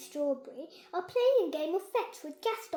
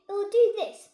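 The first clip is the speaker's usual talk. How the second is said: showing off; 6 semitones higher, 12 dB louder than usual. Acted. Showing off robot.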